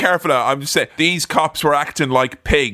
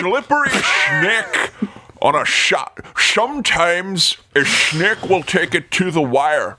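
Sean Connery impression; slower than usual. Shown as a demonstration. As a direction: drag out slippery snake, Connery-style. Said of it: "say that, please".

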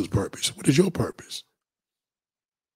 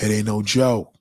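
ordinary talk

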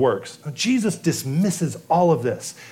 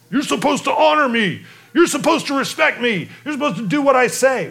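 a hint of a bossy tyrant, higher and pushy; mock demanding boss; 7 semitones higher than usual. Preacher mocking a self-important leader's demands. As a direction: play the petty tyrant demanding honor.

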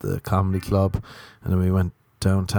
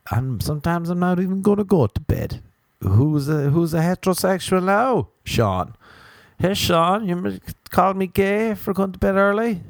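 higher, smug taunting; 10 semitones higher than usual. Pretend. Taunting Sean, his old mate, higher and smug. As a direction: crow over him, higher.

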